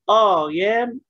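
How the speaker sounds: آه يام said slowly as written, with a drawn-out آه; teacher demonstrating the wrong reading. Shown as a demonstration. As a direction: deliberately mispronounce آه يام. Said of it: "هنطقها غلط".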